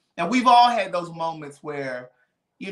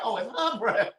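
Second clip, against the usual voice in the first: laughing; 5 semitones higher, 4 dB quieter than usual. Retold or imitated. Retold realisation, laughing.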